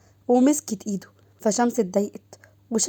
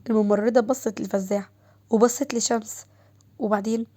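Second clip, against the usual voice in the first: plain reading voice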